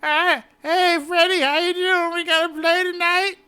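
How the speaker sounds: put-on high creaky elderly voice, way up; old man voice. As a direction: play a frail old man calling his buddy.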